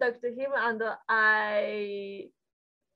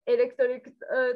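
ordinary delivery

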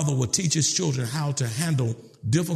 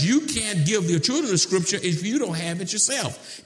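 usual speaking voice

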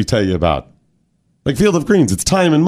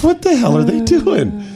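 a hint of a puzzled kid, higher; childhood bafflement; 8 semitones higher than usual. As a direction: ask it as a confused kid would. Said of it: "I was like".

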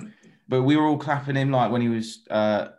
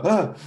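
startled grunt; 9 semitones higher than usual. Retold or imitated. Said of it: "he's like".

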